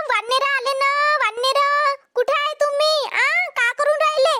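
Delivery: high calls shooing off monkeys across the field; hollering into the field; calling out. Acted. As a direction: yell to scare the monkeys away.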